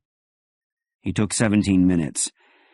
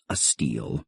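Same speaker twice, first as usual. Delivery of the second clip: dry irony; 8 semitones lower, 6 dB quieter than usual. A narrator's reading. Dry ironic remark.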